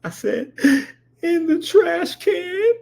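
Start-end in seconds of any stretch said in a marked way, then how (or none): 1.2-2.7 high, slow wailed answer; hammed-up crying whine; mock tearful child. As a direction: imitate a kid confessing through tears. Crying child answers auntie in story.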